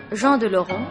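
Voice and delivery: usual speaking voice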